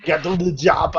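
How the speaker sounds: mock Austrian action-hero line; Arnold Schwarzenegger. Friends trading famous film lines, mock Schwarzenegger voice. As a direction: deliver it as a hammy Arnold. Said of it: "finally got Arnold Schwarzenegger".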